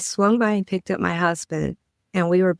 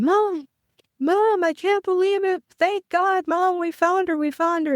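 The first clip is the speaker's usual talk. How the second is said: much higher, tearful joy calling to Mom; new sister shouting thanks to her late mother; overjoyed crying out; 13 semitones higher than usual. Retold or imitated. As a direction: cry out to Mom in disbelief and relief.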